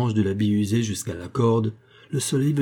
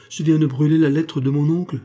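louder, higher cry of dismay; distressed exclamation; 5 semitones higher, 4 dB louder than usual. A narrator's reading. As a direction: blurt it out in alarm. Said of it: "s'écria-t-il".

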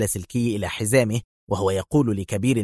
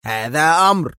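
loud, higher command; angry shouted command; furious order; 5 semitones higher, 5 dB louder than usual. A narrator's reading. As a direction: slam down the order. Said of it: "صاح كبير المفتشين في ثورة".

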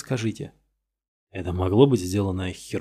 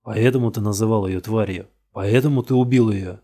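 louder, pressing question; accusing; 5 dB louder than usual. Acted. Detective pushing the suspect to confess, loud and accusing. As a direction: corner him with it.

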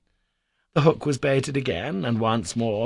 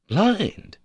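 "Blind?" leaps high and louder in astonishment; surprised cry; 11 semitones higher, 4 dB louder than usual. A narrator's reading. Nephew hears the fish is blind, surprised cry. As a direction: echo "Blind?" in shock. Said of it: "I cried, much surprised".

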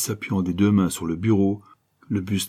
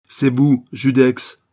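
high, loud, pressing charge; insistent accusation; 5 semitones higher, 9 dB louder, much slower than usual. A narrator's reading. Two bandits bearing down on the detective, loud insistence. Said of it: "insistaient les deux bandits".

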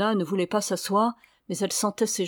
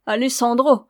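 loud, raised mocking repeat; scornful echo; 4 semitones higher, 7 dB louder than usual. A narrator's reading. Signora echoing Ramona's lover's name, scornfully. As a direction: throw the name back with contempt.

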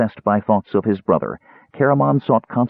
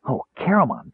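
higher-pitched eager exclamation; excited cry; 6 semitones higher, 3 dB quieter than usual. A narrator's reading. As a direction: cry out to your friend, eagerly. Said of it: "Tass cried".

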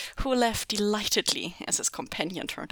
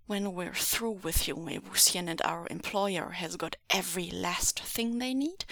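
ordinary delivery

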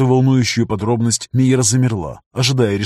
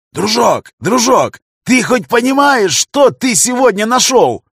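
much higher, louder, slow ecstatic yell; 11 semitones higher, 5 dB louder, slower than usual. A narrator's reading. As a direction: shout with wild joy across the sub. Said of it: "орал он на всю подлодку".

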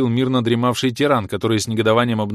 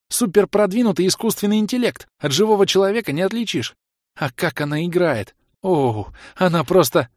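put-on high, loud gushing girl voice; gushing fangirl; 9 semitones higher, 3 dB louder than usual. A narrator's reading. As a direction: rave about her idol breathlessly. Chloe gushing about the star.

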